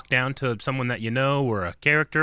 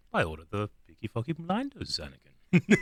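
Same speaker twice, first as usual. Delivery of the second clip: hushed, sinister British emperor impression; much softer, creepy old-villain accent; Palpatine; 6 dB quieter than usual. Shown as a demonstration. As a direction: imitate a scheming galactic emperor, barely voiced. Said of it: "going a little more, like, good Palpatine".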